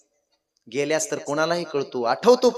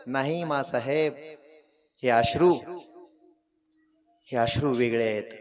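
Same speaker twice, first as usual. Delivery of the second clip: tearful son's reply; 4 semitones lower, 6 dB quieter than usual. Acted. Tearful king answers his mother.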